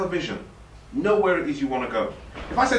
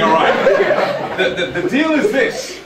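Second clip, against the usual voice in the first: laughing; 8 semitones higher, 5 dB louder, much slower than usual. Real, not acted. Still laughing.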